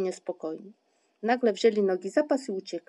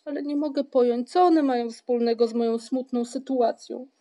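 raised, aggrieved protest; louder, self-pitying complaint; bitter; 4 semitones higher, 7 dB louder than usual. A narrator's reading. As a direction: object loudly, with some bitterness. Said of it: "odpowiedział Paszczak z goryczą".

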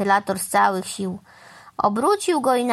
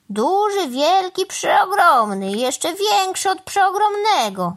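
higher-pitched boastful crow, drawing out the biggest word; grand boasting; 9 semitones higher, slower than usual. Acted. Crow boasting to the cow about something enormous, grand boasting. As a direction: brag with swelling emphasis.

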